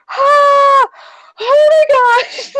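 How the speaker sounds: high shout breaking into a slow, teary squeal; fangirl shout. Real, not acted. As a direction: scream with overwhelmed joy. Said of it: "I'm coming down now".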